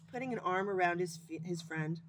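ordinary delivery, nothing marked

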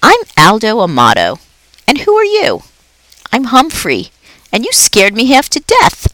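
light, squeaky-edged pet voice; Humphrey the hamster. Acted. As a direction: sound like a timid little hamster who just got a fright.

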